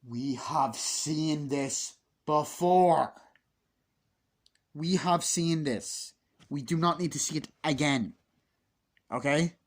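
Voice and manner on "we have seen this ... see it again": slow, louder, each word hammered; every word punched in disbelief; exasperated emphatic. Real, not acted. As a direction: spell it out slowly to an idiot director. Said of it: "and I'm like".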